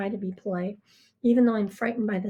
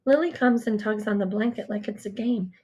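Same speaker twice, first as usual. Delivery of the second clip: usual speaking voice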